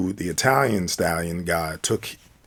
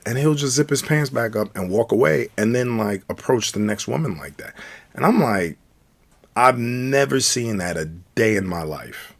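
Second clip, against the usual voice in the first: ordinary delivery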